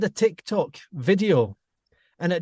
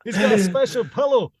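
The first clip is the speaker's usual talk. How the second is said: higher, hammed-up Scottish accent; Scottish film dad impression; 6 semitones higher, 3 dB louder than usual. Quoting film dad teasing his son, exaggerated Scottish. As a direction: play the mocking Scottish dad. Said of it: "he does the same accent in So I Married an Axe Murderer".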